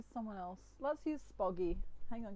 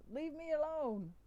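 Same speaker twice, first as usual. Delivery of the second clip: high, indignant brush-off; bad Donkey voice; 7 semitones higher than usual. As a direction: shoo them away in a put-on character voice. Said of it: "donkey goes".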